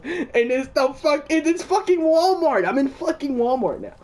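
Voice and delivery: slow, high and incredulous; high disbelieving exclaim. Real, not acted. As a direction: stretch it out in disbelief. Disbelief at scene change.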